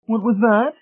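very high-pitched matron voice; puzzled matron. A narrator's reading. Mrs Pritchett asking about an unfamiliar word, high-pitched and puzzled. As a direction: ask in a high, fussy character voice.

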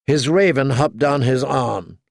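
ordinary delivery

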